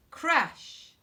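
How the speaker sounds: high, loud crash. A narrator's reading. Narrator telling Otto falling, loud crash. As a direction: shout the crash.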